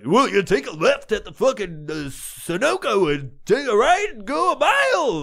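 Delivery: high, loud mock announcer; radio DJ voice. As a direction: give street directions like a radio promo. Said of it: "No one talks like that".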